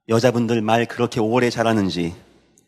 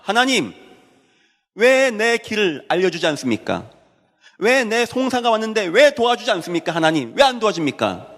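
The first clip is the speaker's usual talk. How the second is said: raised, insistent voice of protest to God; acted-out plea, pitched high; pleading complaint; 8 semitones higher than usual. Preacher voices people asking God why He won't help, pleading complaint.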